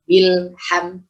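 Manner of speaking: "bilham" with no vowel after lam; clean stopped lam. Shown as a demonstration. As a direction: hold the l, then move straight to ham in "bilham". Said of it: "langsung ke huruf selanjutnya".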